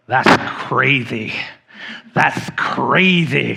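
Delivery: slow lisped "That's crazy"; lisped catchphrase. Shown as a demonstration. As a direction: drag out the lisp on crazy. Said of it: "and we'd say it like this".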